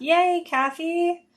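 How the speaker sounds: high, slow, delighted yay; excited cheer. Real, not acted. Sewing host cheers a chat viewer, excited.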